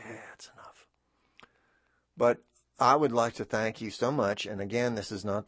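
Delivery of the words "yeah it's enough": soft whisper; hushed, fed-up whisper; whispered aside. Retold or imitated. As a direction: whisper it like a weary friend.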